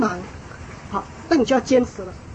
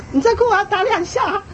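demonstrated warning, raised in pitch; parent's warning; 8 semitones higher than usual. Shown as a demonstration. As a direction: show how to warn a crying child. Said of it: "你跟他讲说再哭要打两下". (示范家长提高音调警告)

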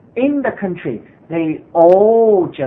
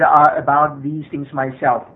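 ordinary talk, nothing marked

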